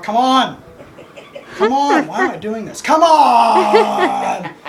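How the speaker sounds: exasperated yell building to a drawn-out "come on"; acted-out impatient hollering; impatient calling out. Whining, impatient filming monologue acted out.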